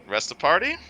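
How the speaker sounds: ordinary talk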